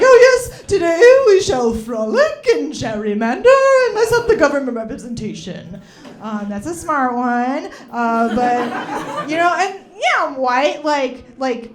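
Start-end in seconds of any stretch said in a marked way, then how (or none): 0.0-5.8 much higher and louder, grand and hammed-up; posh mock aristocrat. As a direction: trill it like a frolicking snob. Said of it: "You know, like".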